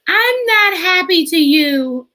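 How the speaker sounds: put-on high, slow, pouty child voice; little son sulking. Mother voicing her son, slow and pouty. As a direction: whine it like a sulky little boy.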